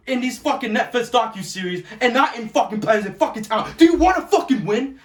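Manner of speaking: building to a loud, furious outburst; angry rant. Real, not acted. Fan ranting at the Red Sox owners, furious.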